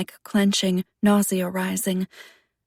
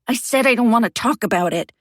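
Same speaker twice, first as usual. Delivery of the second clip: louder, faster angry outburst; 4 semitones higher, 6 dB louder, faster than usual. Acted. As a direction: snap it out in anger. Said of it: "fueled by anger".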